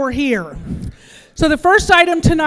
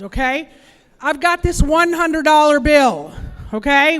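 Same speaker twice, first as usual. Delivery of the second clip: ordinary delivery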